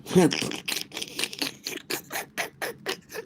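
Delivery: long wordless laughing fit. Real, not acted. Streamer cracking up at own joke, long laugh. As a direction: break into soft, drawn-out chuckling.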